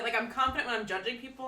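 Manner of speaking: normal talking voice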